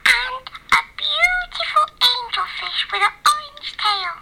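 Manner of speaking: high, sweet little mermaid; a dainty mermaid listing wishes. Acted. Mermaid dictates her Santa letter, high sweet voice.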